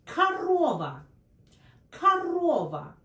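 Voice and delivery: корова said karOva, the first O as A and the second O stressed; корова with the stress on the middle O; Russian корова. Shown as a demonstration. Teacher models the stressed O for learners. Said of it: "the second one is stressed".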